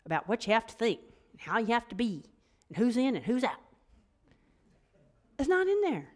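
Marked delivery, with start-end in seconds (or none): 5.4-6.1 emphatic. Real, not acted. Emphatic point in sermon.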